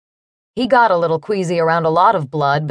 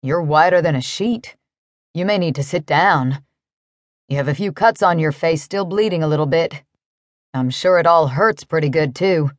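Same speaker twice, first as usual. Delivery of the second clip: concerned cowboy; 4 semitones lower than usual. A narrator's reading. Narrator voices the cowboy.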